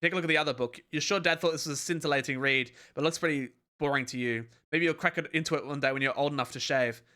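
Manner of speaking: ordinary delivery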